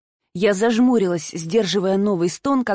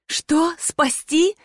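higher, shocked disbelief; stunned disbelief; 7 semitones higher, much slower than usual. A narrator's reading. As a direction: gasp it out astonished. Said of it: "выдохнула удивлённо".

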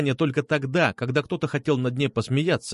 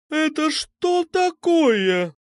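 high, slow, drawn-out boom; thundering; much slower than usual. A narrator's reading. Medusa discovers boys fighting, thundering voice. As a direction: thunder it slowly, high and commanding. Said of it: "прогремел знакомый голос".

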